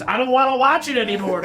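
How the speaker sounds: mock high-pitched exasperation; exasperated wife. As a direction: act out a fed-up wife's protest. Acting out exasperated wife's refusal.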